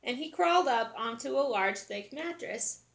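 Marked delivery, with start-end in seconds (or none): none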